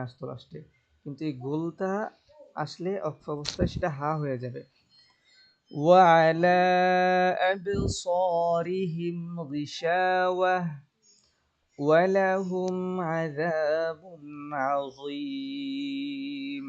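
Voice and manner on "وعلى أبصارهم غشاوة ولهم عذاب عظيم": slow, high, drawn-out tajweed chant; Quran recitation. Shown as a demonstration. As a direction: sing the verse slowly with long held vowels. Recitation resumes.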